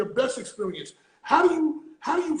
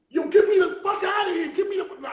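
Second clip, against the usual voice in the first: higher, louder freaked-out shout; panicked yell; 7 semitones higher, 4 dB louder than usual. Retold or imitated. Tripping in front of the mirror, shouting to his daughter in panic. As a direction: yell to be let out, spooked.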